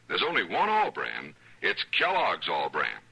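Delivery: ordinary talk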